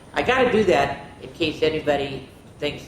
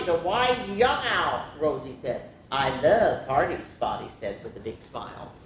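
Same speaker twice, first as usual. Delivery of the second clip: lively picture-book reading; expressive bedtime-story narrator; story read-aloud; 4 semitones higher than usual. A narrator's reading. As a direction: read it to children, voicing each animal lightly.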